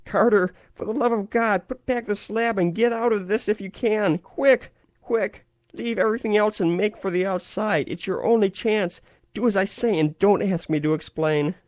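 high, frantic urgent warning; wild consternation. A narrator's reading. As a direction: urge him out in wild panic. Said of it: "in a pitch of wilder consternation".

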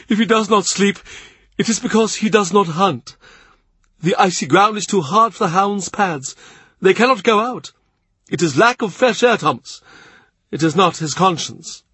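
dry cardinal amused at his guest. A narrator's reading. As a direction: voice a wry, worldly cardinal making light of it. Narrator voices the cardinal.

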